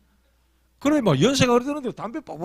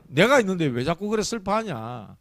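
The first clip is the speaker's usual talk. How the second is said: ordinary talk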